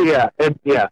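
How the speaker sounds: a real laugh into yeah. Real, not acted. Laughing along at the host's joke. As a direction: laughs then agrees lightly.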